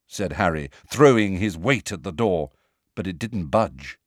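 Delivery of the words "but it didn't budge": plain reading voice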